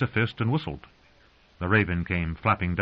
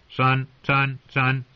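raven croak; 5 semitones higher, 4 dB louder, much slower than usual. A narrator's reading. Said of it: "called Mormont's raven".

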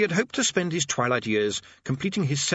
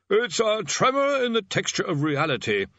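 senior tutor voice; 10 semitones higher than usual. A narrator's reading.

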